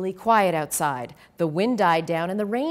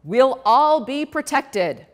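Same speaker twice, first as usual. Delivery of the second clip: loud, slow, booming promise; bellowing mayor; 4 semitones higher, 6 dB louder, slower than usual. A narrator's reading. Mayor reassuring the crowd, slow and loud. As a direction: boom it out slowly. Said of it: "he bellowed".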